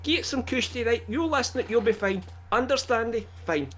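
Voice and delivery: broad Glaswegian, pitched high; full-on Glasgow patter; Glaswegian accent. Shown as a demonstration. Co-host shows off his undiluted Glaswegian to a Spanish listener in a broad Glasgow accent. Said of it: "That's my, that's Glaswegian".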